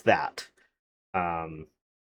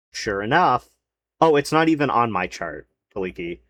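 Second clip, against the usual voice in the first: ordinary delivery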